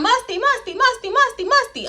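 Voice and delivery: high, sing-song repeated name; repeated calling. Retold or imitated. Partygoers repeating Musty's name, high sing-song. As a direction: echo the voices chanting the name, high.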